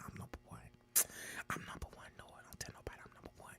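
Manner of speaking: voiceless whisper, a secret aside. Real, not acted. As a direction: murmur it to yourself under your breath.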